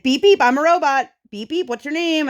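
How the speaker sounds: high, loud mock-angry kid robot; imitated indignant robot-game squeal; mad child robot. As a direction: insist shrilly like a cross child playing robot. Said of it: "she would get mad".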